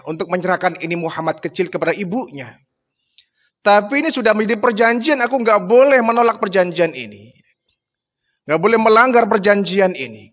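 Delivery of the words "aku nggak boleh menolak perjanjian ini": usual speaking voice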